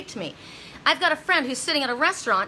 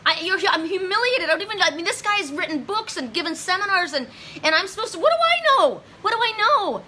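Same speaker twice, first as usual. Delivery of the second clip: retold distressed plea; 6 semitones higher than usual. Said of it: "I was crying".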